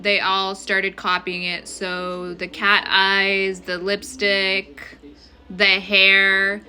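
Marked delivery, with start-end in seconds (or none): none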